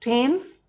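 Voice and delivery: "थेम्स" said with a slight h after the t; Thames with light h. Shown as a demonstration. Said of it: "ह sound देते हैं". ("थेम्स" हल्की ह की आवाज़ के साथ)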